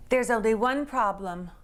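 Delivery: usual speaking voice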